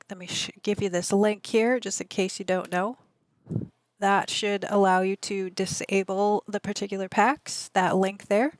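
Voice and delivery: ordinary talk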